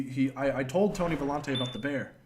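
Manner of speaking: ordinary talk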